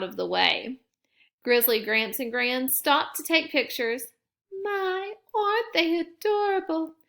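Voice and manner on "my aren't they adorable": tender grandma. A narrator's reading. Granny to the cubs, high and doting. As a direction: coo like a doting grandmother, higher. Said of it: "said Gran, wiping away a tear".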